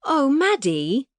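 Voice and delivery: put-on high, slow, prim and proper English accent; fond sigh of "Oh, Maddie" in posh English. A narrator's reading. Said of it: "the prim and proper accent".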